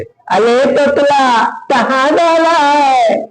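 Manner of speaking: high, slow teasing sing-song; mocking taunt. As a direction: drawl the taunt like village boys. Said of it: "तोतला कहकर चिढ़ाते थे". (चिढ़ाती धीमी ऊँची आवाज़)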